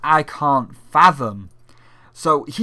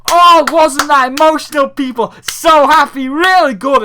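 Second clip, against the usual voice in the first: very high, loud, fake-bubbly host voice; mock cheery hosts; 11 semitones higher, 11 dB louder than usual. Mocking the hosts cheering after the dad's speech, very high and loud. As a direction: squeal it like an oblivious cheerful presenter. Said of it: "Jeff Keighley and Matt Pat come back and it's like".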